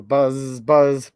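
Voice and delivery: low, drawn-out buzz; bee buzzing. Acted. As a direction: stretch the zz like a bee. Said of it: "He can sound like a bee".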